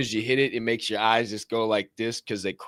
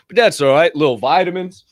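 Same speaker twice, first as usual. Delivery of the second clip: usual speaking voice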